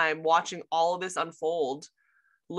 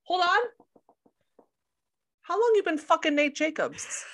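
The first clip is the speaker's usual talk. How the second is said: confronting Cassie; 9 semitones higher, 3 dB louder than usual. Retold or imitated. Rue turning on Cassie about Nate, mock-incredulous. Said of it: "And she's like".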